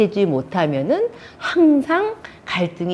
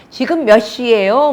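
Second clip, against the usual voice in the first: raised, louder pointed question; annoyed wife; 5 semitones higher, 5 dB louder than usual. Acted. Wife to her late-returning husband, annoyed. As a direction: ask the time as a reproach. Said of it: "화나서 얘기했고".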